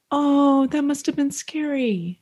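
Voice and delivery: high-pitched tender sympathy; gentle concern. Acted. Partner soothing someone after a scare, tender concern. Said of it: "if I was like".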